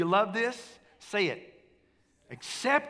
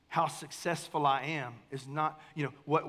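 normal talking voice

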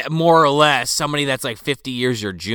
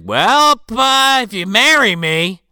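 mock villain proposal; 7 semitones higher, 4 dB louder, slower than usual. Villain Barnaby's bargain, acted.

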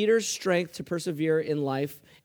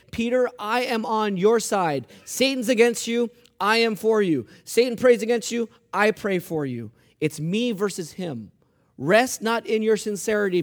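firm, raised preaching voice in short declarations; emphatic assurance; 3 semitones higher, 4 dB louder than usual. Acted. Jesus assuring Peter he is on his side, emphatic. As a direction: drive each contrast home forcefully. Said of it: "He's emphatically saying".

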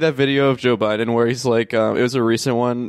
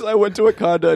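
higher, lightly imitated politician; Joe Biden; 7 semitones higher than usual. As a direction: retell the gaffe in a lightly put-on voice. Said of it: "He says".

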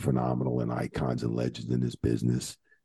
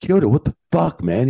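much higher and louder mock yell; Bubba yelling; 11 semitones higher, 6 dB louder than usual. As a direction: bellow it at Kyoto like an angry Bubba. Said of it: "he goes".

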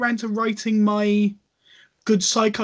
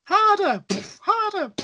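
mock yell; 10 semitones higher, slower than usual. Mock cry during a fight.

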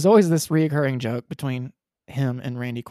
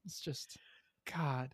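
whispered aside; 3 semitones lower, 16 dB quieter, slower than usual. Real, not acted. Exasperated aside on Chevy Chase.

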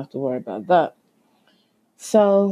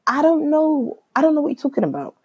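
high, insistent retold protest; exasperated denial; 10 semitones higher, faster than usual. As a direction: stretch the first denial, then rush the repeat. Retells insisting to agent.

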